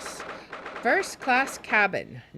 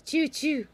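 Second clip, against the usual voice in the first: mock mouse squeak; 7 semitones higher than usual. Mouse sound voiced again.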